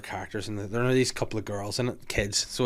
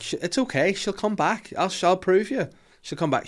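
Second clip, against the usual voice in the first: higher-pitched mock innocent girl; innocent child; 9 semitones higher, 5 dB louder than usual. Retelling Lizzie reassuring the adults, innocently. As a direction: act out a little girl's untroubled reassurance. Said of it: "all innocently".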